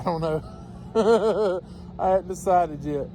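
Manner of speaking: chuckling through the words; slow, amused laughing admission. Real, not acted. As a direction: laugh it off, slowly. Laughs while admitting indecision.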